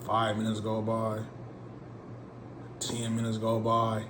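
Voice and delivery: ordinary delivery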